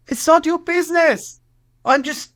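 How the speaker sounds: high-pitched indignant protest; retold indignant retort. Retells arguing with officer.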